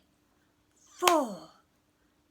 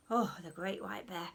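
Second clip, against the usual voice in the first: ordinary delivery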